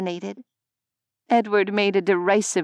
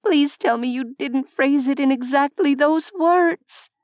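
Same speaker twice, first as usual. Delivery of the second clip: higher, pained and pleading; lightly voiced young woman dreading the answer; pleading cringe; 6 semitones higher than usual. A narrator's reading. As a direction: say it already wincing for him. Nevaeh cringes on his behalf.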